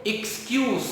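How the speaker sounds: एक्सक्यूज said softly with a hissing s ending; excuse with z sound. Shown as a demonstration. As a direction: say एक्सक्यूज with a clear s at the end. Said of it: "सा का sound आता है ऐसा".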